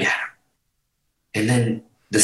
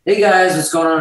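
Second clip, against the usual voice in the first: higher, awkwardly friendly hello, mildly imitated; uncomfortable greeting; 5 semitones higher than usual. Booking agent greets the band in the dressing room, uncomfortable. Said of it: "he's a little uncomfortable".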